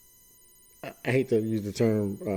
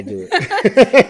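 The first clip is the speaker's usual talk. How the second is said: laughing; 10 semitones higher, 8 dB louder than usual. Real, not acted. Laughing at own struggle.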